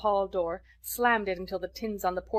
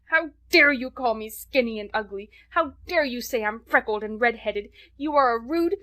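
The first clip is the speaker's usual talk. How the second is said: put-on much louder, higher child screaming at an adult; furious outburst; 5 semitones higher, 8 dB louder than usual. A narrator's reading. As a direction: rage pouring out, building.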